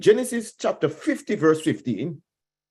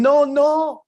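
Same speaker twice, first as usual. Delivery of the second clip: loud, higher ringing no, no; emphatic no; 9 semitones higher, 6 dB louder than usual. Real, not acted. Preacher to congregation, rising emphatic shout. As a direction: hammer home the denial. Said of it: "watch how forgiveness works".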